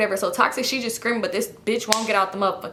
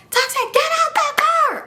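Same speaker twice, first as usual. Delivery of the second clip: very high, strained mock scream; storyteller acting out her own scream; retold screaming. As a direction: screech the order without real volume. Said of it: "I'm screaming".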